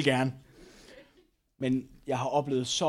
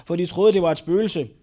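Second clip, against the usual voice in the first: ordinary delivery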